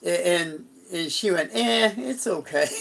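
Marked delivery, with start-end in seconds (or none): 1.5-2.7 a shrugging, unimpressed "eh, it's okay"; imitating blasé Debbie. Debbie in the afterlife, asked what it's like, mock blasé. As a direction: toss it off like nothing special. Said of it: "she could be real blasé".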